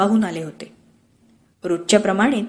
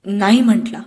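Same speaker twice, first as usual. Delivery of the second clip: ordinary delivery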